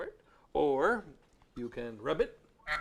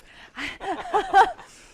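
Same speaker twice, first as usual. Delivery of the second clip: loud high laugh; 13 dB louder than usual. Acted. Laugh before practising straw.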